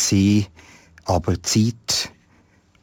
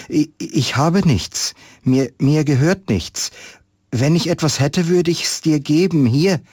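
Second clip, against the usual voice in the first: put-on higher, stammering boy's voice, flustered; a nervous child blurting it out; agitated boy; 8 semitones higher than usual. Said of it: "Da wurde der Junge ganz aufgeregt". (aufgeregter Junge, höher und stockend)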